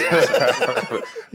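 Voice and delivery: amused laugh at the question. Real, not acted. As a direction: burst of real laughter. Laughs at being asked.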